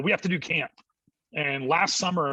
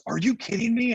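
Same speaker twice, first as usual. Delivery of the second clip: put-on excited kid; 9 semitones higher than usual. Kid retold, excited high pitch.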